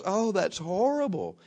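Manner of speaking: lightly voiced young woman, high, slow sympathy; hammed-up pity for a broken tooth; mock sympathetic young woman. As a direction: play the shocked young lady with slow sympathy.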